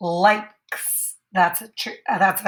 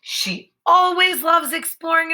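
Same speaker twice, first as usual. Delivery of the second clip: higher, lively, "always" lifted; with emotion; 9 semitones higher, 3 dB louder than usual. Shown as a demonstration. Teacher reading the sentence with lots of emotion. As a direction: say it bursting with feeling. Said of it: "I'm saying it, like, with a lot of emotion".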